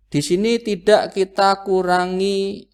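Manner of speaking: ordinary delivery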